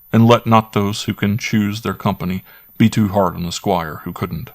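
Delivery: plain reading voice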